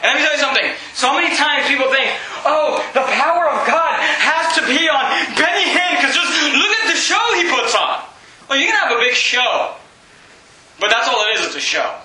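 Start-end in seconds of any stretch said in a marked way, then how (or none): 2.4-8.0 hammed-up gushing admirer, higher pitched; mocking over-excited wonder; mock awe. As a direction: gush like an awestruck fan. Voicing gullible admirers of a show.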